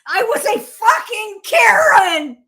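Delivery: loud, high and slow, laughing; laughing shout. Real, not acted. Admitting to her friend she was a Karen, laughing shout.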